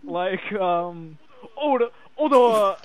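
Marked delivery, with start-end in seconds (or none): 1.6-2.8 loud, high ad-style shout; Terry Crews commercial shout. Retold or imitated. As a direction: bark it like a hyped TV commercial. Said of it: "what did he say in the commercial".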